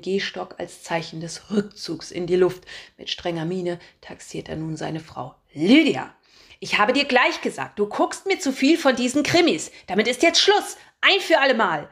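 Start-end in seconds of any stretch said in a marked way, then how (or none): 5.5-11.9 put-on higher, loud stern old-man lecture; scolding old man. A narrator's reading. Old Alfred sternly scolds his wife about crime shows. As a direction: tell off your wife once and for all.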